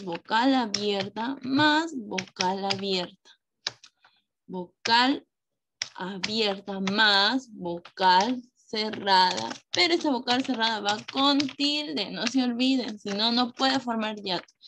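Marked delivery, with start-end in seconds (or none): 0.0-9.5 slow, drawn-out phrases with pauses; slow dictation. Real, not acted. Teacher recalling the hiatus rule for pupils, slow dictation. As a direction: dictate the rule piece by piece, stretching the words. Writing the rule out while saying it.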